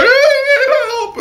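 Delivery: high, drawn-out mock cry for help; hammed-up panicked call. Calling ambulance for dead man.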